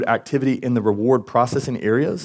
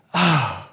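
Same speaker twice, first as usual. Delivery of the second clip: long, disappointed exhale; sigh; 3 semitones higher than usual. Acted. Fatherly God faces disobedience, disappointed sigh. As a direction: breathe out a heavy, audible sigh. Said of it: "He sighs and goes like".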